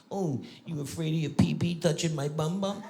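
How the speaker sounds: mock him in soft baby talk; buddy's babyish taunt. Grown buddy teasing about riding together, babyish. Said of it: "He talks like that".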